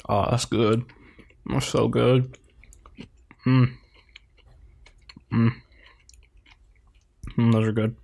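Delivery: eating olives while talking; mouth full. Real, not acted. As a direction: talk through a mouthful of olives. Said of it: "you sound like a really unenthusiastic guy having sex".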